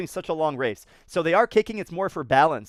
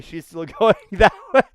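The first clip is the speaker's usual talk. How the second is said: laughing aside; 7 dB louder than usual. Real, not acted. Laughing at lagging swimmer.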